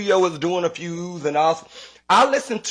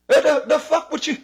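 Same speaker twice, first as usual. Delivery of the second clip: high-pitched exasperated sputter; exasperated outburst; 11 semitones higher than usual. Real, not acted. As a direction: voice jumps way up, cracking in disbelief.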